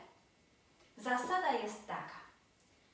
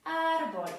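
Árbol with a raised, forceful first syllable; stressed tonic syllable; 6 semitones higher, 8 dB louder than usual. Shown as a demonstration. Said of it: "w sylabie tonicznej nad samogłoską".

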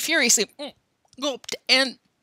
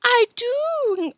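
put-on high, drawn-out baby-talk teasing; singsong mock little girl; shrill, girlish voice; 4 dB louder, slower than usual. A narrator's reading. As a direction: taunt them in a girlish falsetto. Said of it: "calling in a shrill, girlish voice".

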